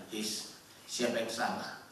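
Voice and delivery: put-on soft, slow question to a child; softly acted fatherly question; retold gentle questioning. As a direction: ask it quietly, as a father to his son. Retells gently questioning crying son.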